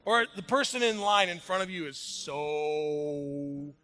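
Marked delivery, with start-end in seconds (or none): 2.0-3.7 drawn-out slow. Retold or imitated. Drawing out slow.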